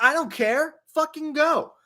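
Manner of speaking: high sharp mock yell at the son; retold yelling dad. Father yelling at stepson.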